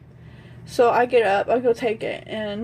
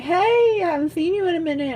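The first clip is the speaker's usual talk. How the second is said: imitated gushing greeting; 6 semitones higher, faster than usual. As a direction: mimic parents greeting a long-missed guest. His parents greet her, retold.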